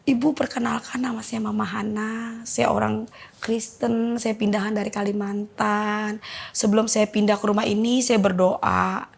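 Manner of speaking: slow, careful retold introduction; orderly, measured speech. New neighbour introducing herself to the mother, slow and orderly. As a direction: imitate someone speaking slowly in a composed order. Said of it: "agak tertata-tata".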